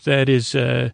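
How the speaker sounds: ordinary talk, nothing marked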